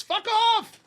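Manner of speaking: high-pitched mock indignation; scornful dismissal. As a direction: wave them off in a high, incredulous voice. Mocking the upset hippies.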